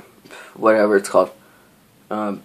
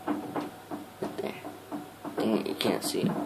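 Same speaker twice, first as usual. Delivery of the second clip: whispered frustration; hushed annoyed aside; 15 dB quieter than usual. Real, not acted. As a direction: mutter it under your breath. Frustrated muttering while filming.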